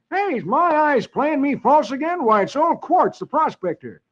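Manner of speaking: high, loud surprised cowboy; excited exclaim. Acted. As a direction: call out in glad surprise. Brad exclaims on seeing hermit.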